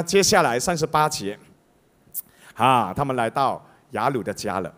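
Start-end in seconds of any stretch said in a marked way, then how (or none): none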